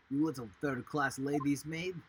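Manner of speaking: Russian sailor, loud and scornful; Russian accent. Acted. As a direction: sneer it in a heavy Russian accent. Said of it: "probably Russian".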